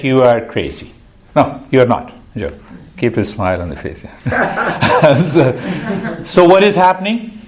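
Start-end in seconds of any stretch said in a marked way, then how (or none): 4.2-7.4 high, quick, still laughing; laughing question. Real, not acted. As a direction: ask it through leftover laughter. Laughing after teasing a student.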